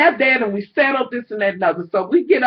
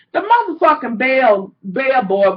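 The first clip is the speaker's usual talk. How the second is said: ordinary delivery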